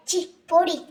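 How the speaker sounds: loud, high scornful dismissal; a sharp angry brush-off; contemptuous snap. Acted. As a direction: spit the words out in disgust. He scornfully kicks her away.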